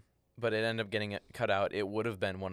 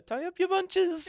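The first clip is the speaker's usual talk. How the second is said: high kiwi voice; 6 dB louder, slower than usual. Retold or imitated. Recalling the bunches bit.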